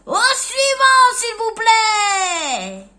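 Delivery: drawn-out booming call; vendor shouting. Acted. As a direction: bellow for the next in line. Vegetable seller dismisses customer.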